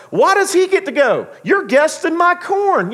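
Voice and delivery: high, loud indignant complaint; fuming. Retold or imitated. Ray fuming that another man gets chosen, indignant high outburst. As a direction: splutter it out like a wronged man. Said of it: "Kevin Costner's character is fuming".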